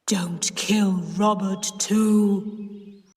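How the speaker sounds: slow, ghostly deep warning; deep, terrible voice. A narrator's reading. Sis warning her aunt through the pipe, deep terrible voice. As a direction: drag the words out to haunt her. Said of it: "in a deep, terrible voice".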